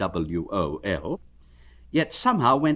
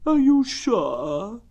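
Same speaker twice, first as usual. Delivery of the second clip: very slow, high, drawn-out mournful donkey; gloomy Eeyore; 14 semitones higher, 4 dB louder, much slower than usual. Acted. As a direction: drag it out like a sad old donkey. Eeyore doubtful.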